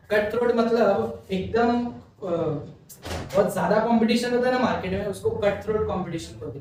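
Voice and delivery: ordinary talk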